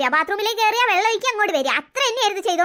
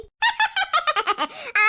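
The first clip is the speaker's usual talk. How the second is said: gleeful laugh; 5 semitones higher than usual. Acted. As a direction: burst out laughing at the prank idea. Sisters delighted by prank idea. (ഉറക്കെ പൊട്ടിച്ചിരി)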